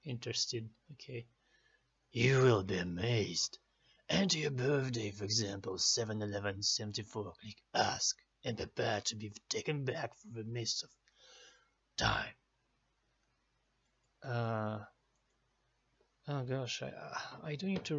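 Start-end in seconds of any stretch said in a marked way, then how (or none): 2.1-12.3 deep ominous fortune teller; horror host. Acted. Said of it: "fun Celtic stuff".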